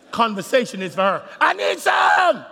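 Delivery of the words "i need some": high, loud and slow shouted plea; slow, loud, high husband's howl of need; needy husband, drawn out. Retold or imitated. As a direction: stretch the begging out as a hammed-up howl. Husband voiced wanting sex, repeated.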